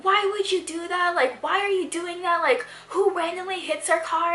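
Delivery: high-pitched imitation of an angry stranger yelling; mock angry yelling. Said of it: "like, yelling at us".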